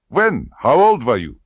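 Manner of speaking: high, loud, demanding questions; harsh interrogator. Acted. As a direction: fire the questions at her. Klebb interrogates.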